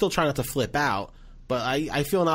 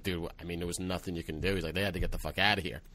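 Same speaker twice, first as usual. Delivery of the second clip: low mumbled buddy voice; 6 semitones lower, 7 dB quieter, faster than usual. Retold or imitated. Headliner Jim consoling the opener.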